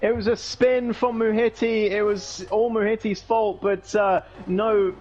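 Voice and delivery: fast lively call of the chaos; motorsport commentator. Acted. Crash and restart call.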